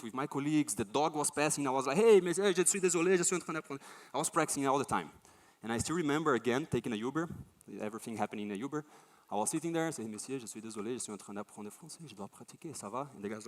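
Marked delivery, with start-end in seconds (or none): 2.0-3.8 high, loud opening that eases off; hammed-up overeager French greeting; eager calling out. Accosting even a passing dog. 10.1-13.1 very soft, rehearsed French patter; imitating his own quiet, practised plea; rote recital. Reciting his line to Uber driver.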